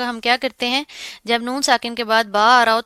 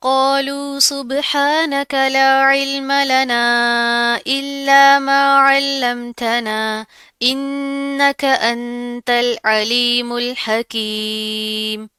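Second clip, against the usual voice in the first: Quran recitation; 2 semitones higher, 4 dB louder, much slower than usual. Shown as a demonstration. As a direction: recite the verse in full tajweed. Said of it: "teacher recites the full verse". (धीमी ऊँची तिलावत)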